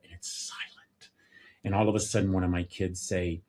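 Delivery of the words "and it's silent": breathy hushed whisper, slowed. Acted. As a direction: drop to a whisper to show the silence. Hushed car after dropping boy off.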